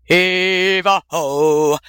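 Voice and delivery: long, drawn-out hauling call; sailors' work shout. A narrator's reading. Sailors hauling chests out of the hold, work shout. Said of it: "they shouted".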